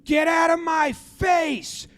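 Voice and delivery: high, drawn-out yelling imitation; mock angry yell. Yankees coach to the Orioles coach, high mock-angry yell. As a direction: act out a coach yelling to back off. Coach with finger in his face.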